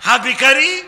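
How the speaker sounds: loud, projected rallying of a crowd; call-and-response preaching. Real, not acted. Preacher drilling children for loud answers, call-and-response preaching. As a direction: throw each phrase out for the crowd to finish.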